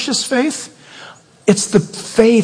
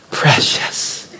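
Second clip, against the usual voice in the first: soft whispered "Precious"; whispered word; 7 semitones lower, 3 dB quieter than usual. Shown as a demonstration. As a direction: breathe the word "Precious" reverently. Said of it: "he'll use the word precious".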